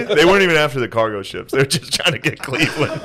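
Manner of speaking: ordinary talk, nothing marked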